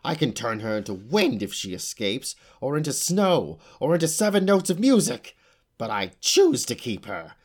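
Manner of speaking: climbing in pitch as the threat builds; fierce with fear. A narrator's reading. Witch threatening the unicorn, fierce and afraid. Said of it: "voice was fierce with fear".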